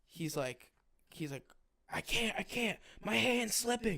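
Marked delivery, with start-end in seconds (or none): 1.9-4.0 high-pitched mock panic; imitating panic. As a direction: voice his freak-out, pitched well up. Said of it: "He's freaking out".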